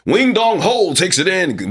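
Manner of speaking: high, slow, buttoned-up announcer read; polite newsroom announcer call; formal sportscaster read. Shown as a demonstration. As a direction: read the name like a stiff broadcaster. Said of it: "instead of being like".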